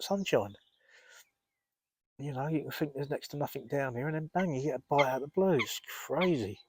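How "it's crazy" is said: ordinary talk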